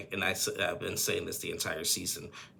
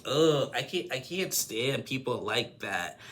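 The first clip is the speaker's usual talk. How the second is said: slow, higher-pitched exasperation; groan, then vent slowly in frustration; fed-up and drawn out; exasperated; 5 semitones higher, slower than usual. Real, not acted. Vents about a pet peeve.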